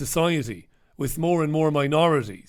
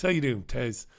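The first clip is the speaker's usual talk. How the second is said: normal talking voice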